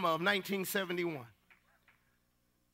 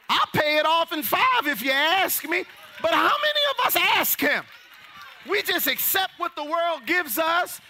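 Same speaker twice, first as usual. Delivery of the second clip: fiery preaching; 7 semitones higher than usual. Acted. Pastor voices God to the congregation, rising preaching fire. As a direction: preach it to the rafters.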